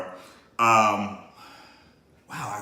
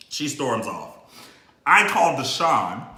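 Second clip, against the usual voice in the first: usual speaking voice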